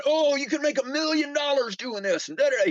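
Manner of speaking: high, gushing hype voice; excited friend. Retold or imitated. Imitating his friend Rick pitching a money scheme, high and gushing. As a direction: oversell it like a get-rich pitch. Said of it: "Rick would come in".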